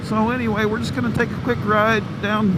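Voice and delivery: muffled inside a motorcycle helmet; talking inside a closed helmet. Real, not acted. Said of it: "I've got my new Shoei helmet".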